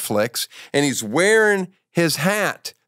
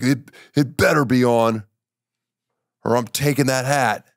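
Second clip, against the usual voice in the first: mock threat in the manager's voice; lightly voiced stern manager; manager impression; 3 semitones lower than usual. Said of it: "impression of me with a low voice".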